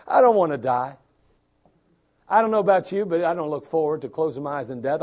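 ordinary talk

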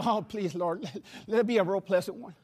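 quick hushed plea to the Lord at the door; whispered prayer. Retold or imitated. Preacher at a stranger's door, softly praying. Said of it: "I'm like".

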